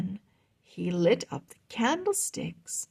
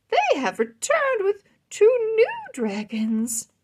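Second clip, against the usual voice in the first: high, bright-eyed queenly voice; delighted queen; 9 semitones higher, 4 dB louder than usual. Acted. Queen teasing King Roland at the feast, high and delighted. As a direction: play the thrilled queen, much higher.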